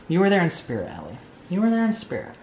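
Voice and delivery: ordinary delivery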